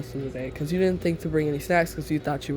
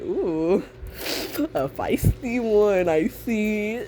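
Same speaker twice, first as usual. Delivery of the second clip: higher, drawn-out amused tease; amused teasing; 4 semitones higher, much slower than usual. Acted. Larry, smiling, reacts to the quarrel, amused teasing. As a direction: savour it slowly, with a smile. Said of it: "Like, he's smiling".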